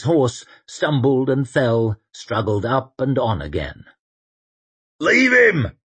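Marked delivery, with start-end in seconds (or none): 5.0-5.8 high, urgent yell; loud, high-pitched holler; shouted command. A narrator's reading. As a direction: shout it across a battlefield. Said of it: "shouted Sweet".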